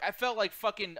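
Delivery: ordinary talk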